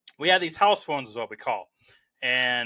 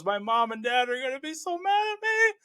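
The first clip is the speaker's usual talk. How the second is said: high, whiny mock-crying Stu; a teen killer whimpering he'll be in trouble; 10 semitones higher than usual. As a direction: imitate Stu blubbering about his parents. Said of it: "how Matthew Lillard does it".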